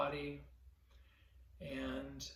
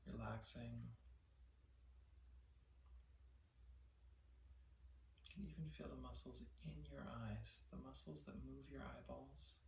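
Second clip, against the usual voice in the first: guided meditation; 3 semitones lower, 13 dB quieter than usual. Real, not acted. Teacher leading listeners to relax their body, soft guided meditation. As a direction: softly guide them to let go, phrase by phrase.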